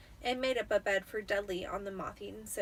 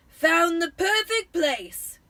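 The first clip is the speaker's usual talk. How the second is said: loud, slow, higher booming uncle; Uncle Vernon, pleased; 7 semitones higher, 12 dB louder, much slower than usual. A narrator's reading. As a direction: announce it, very pleased with himself. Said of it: "he said".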